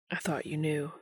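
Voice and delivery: low and soft; still quiet. A narrator's reading. Dean telling Castiel quietly, in the dark library. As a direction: keep it soft, just for him. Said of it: "still quiet".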